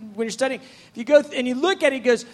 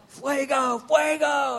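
mock yell; 4 dB quieter, slower than usual. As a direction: imitate a crowd yelling, without full volume. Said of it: "this group of people yelling".

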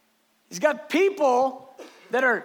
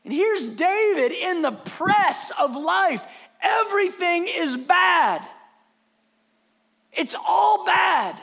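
higher, slow and emphatic sermon delivery; impassioned preaching; 7 semitones higher, 3 dB louder, slower than usual. Acted. Preacher describing David in the press of life, impassioned. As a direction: drive each phrase home to the congregation.

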